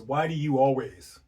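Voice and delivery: sharp reproach to a partner; accusing tone. Shown as a demonstration. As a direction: throw it out as an angry accusation. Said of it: "I'm using that tone".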